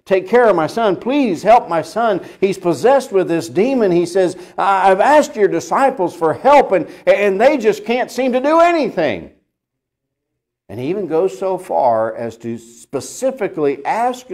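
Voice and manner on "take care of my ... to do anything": higher, desperate begging father; pleading father. Acted. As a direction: beg like a father afraid for his son. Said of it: "he's pleading with Jesus".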